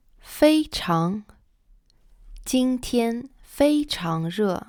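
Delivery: "非常", then "今天非常热", slow, each tone clear; slow model sentence. Shown as a demonstration. Teacher models "今天非常热" for students, slow and clear. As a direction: give "今天非常热" slowly for learners. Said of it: "For example".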